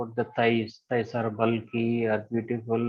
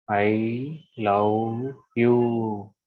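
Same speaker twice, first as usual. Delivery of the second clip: slow affirmation; much slower than usual. Acted. Drawn-out affirmation in meditation.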